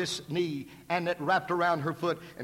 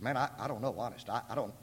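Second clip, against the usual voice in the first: nervously tripping over words; flustered stammer; 6 semitones lower, 8 dB quieter, faster than usual. Retold or imitated.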